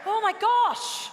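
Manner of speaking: high, loud, slow exclamation; exasperated exclamation. Pretend. Host to the maestro who finally answers, exasperated exclamation. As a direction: stretch it out in exasperation.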